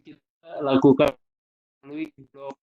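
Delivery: choppy, cutting-out connection; breaking up space radio. Real, not acted. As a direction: voice dropping out on a call.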